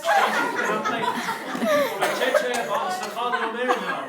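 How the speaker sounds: high and loud, laughing through it; breaking up while pleading with the driver; laughing frantic plea. Real, not acted. Begging the driver to stop the phone call, laughing hard.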